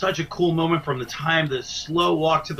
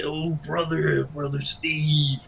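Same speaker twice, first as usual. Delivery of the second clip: slow, drawn-out wrestler impression; Macho Man. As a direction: stretch the words in an imitated wrestler's voice. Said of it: "do you do a Macho Man imitation".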